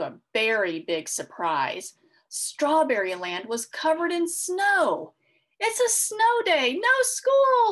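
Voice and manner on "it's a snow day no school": high, loud excited cheer. Acted. Strawberry cheering to her sister about snow day, high and loud. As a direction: squeal with joy at the snow. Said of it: "Strawberry Shortcake cheered".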